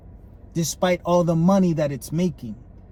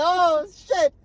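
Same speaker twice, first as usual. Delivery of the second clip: exasperated quote; 10 semitones higher than usual. Retold or imitated. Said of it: "I'm like".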